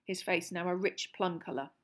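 ordinary delivery

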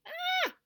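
short, very high scream; loud startled shriek. A narrator's reading. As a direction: let out a sudden piercing squeal. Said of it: "She gave a scream".

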